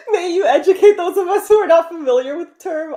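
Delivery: high, loud, giggling through a fake-polite request; mock-courtly plea between giggles; laughing mock formality. Real, not acted. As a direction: ask it grandly while still laughing.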